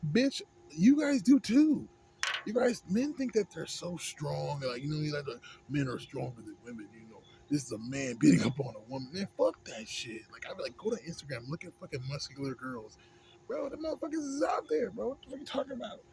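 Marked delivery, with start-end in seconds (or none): 0.1-4.6 much higher, louder and slow, hammering it home; a heated comeback at red pill men; angry retort. Real, not acted. Arguing back at red pill men. 13.5-16.0 incredulous and a little aggravated; a real irritated rant about men's strength. Real, not acted. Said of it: "I'm just a little aggravated".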